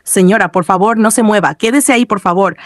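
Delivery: ordinary delivery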